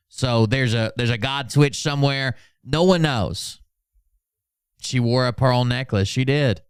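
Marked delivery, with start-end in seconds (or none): none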